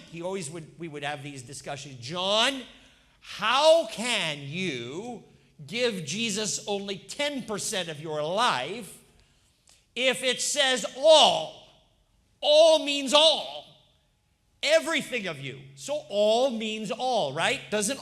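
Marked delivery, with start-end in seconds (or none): 2.0-11.7 loud, slow, rising pulpit appeal; full-force preaching. Acted. Pastor preaching to the congregation, full-force pulpit voice. 12.4-13.6 All means all, the word all shouted high and slow; thundering proclamation. Acted. Pastor proclaiming to the congregation, loud and slow. Pastor hammers the key phrase.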